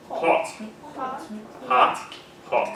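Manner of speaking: hot repeated with a British aw vowel. Shown as a demonstration. Teacher drilling the British hot. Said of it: "a shade darker".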